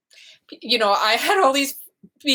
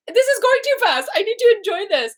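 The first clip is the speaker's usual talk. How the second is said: upset plea; 14 semitones higher, 4 dB louder than usual. Retold or imitated. Upset mother in labor.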